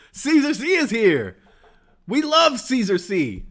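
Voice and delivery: high, slow, gushing fan squeal. Retold or imitated. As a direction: stretch it out like a starstruck fan. Repeats it bigger, slower.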